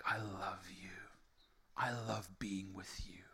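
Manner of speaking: soft, low and breath-only; tender whisper. Acted. God smiling back at his child, tender hushed whisper. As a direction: murmur it as a loving reassurance.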